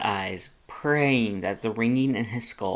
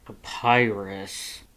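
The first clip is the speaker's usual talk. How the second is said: growled warning; 2 semitones lower, much slower than usual. Acted. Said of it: "Dance growled a warning".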